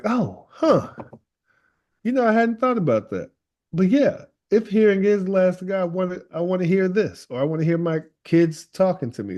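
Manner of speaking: high-pitched, acted "never considered that"; pondering realization. People answering her question about a last song, pondering aloud.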